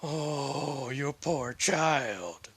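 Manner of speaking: long, drawn-out sympathetic coo; pitying. Acted. Protector moved by the lonely child, slow pitying sigh of words. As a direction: stretch it slowly with pity. Reacting to the tearful child's confession.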